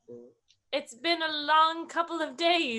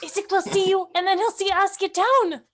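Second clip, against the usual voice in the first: louder, higher worried scolding; urgent parental order; 6 semitones higher, 6 dB louder than usual. Acted. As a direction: urge the child down, raised voice. Urgently orders child off roof.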